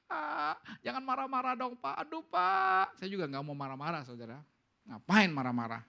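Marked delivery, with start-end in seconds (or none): 0.0-3.0 lightly voiced high whiny complaint from a listener; mock the congregation's pleading whine; whining listener. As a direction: congregation members begging the pastor to stop scolding, mock whine. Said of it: "Mungkin telingamu minggu-minggu ini".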